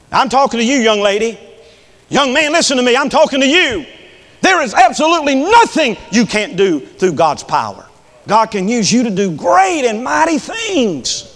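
high, urgent sermon voice; impassioned preaching. Real, not acted. Preaches directly at young listeners.

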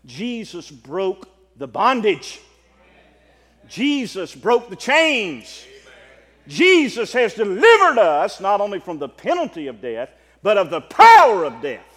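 slow proclamation building to a shout; climactic preaching. Real, not acted.

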